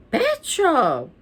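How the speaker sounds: high-pitched excited call. A narrator's reading. Stink to his parents while rushing inside, calling out. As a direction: call it back to someone behind. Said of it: "Sting called to his parents".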